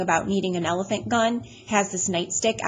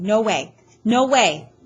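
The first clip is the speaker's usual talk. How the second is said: louder, rising refusal; frantic denial; 3 semitones higher, 7 dB louder, slower than usual. A narrator's reading. Boy going nuts at the hospital news, frantic. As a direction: refuse it loudly, losing control. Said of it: "all of a sudden, I just go nuts".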